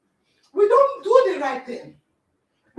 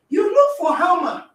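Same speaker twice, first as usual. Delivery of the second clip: ordinary talk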